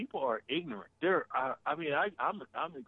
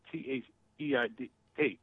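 ordinary talk

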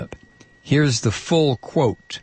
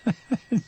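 short disbelieving laugh; 12 semitones higher, 4 dB quieter than usual. Real, not acted. Host reacts to being called liar.

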